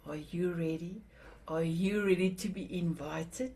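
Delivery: usual speaking voice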